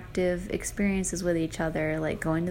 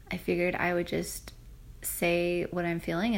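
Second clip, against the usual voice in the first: ordinary talk, nothing marked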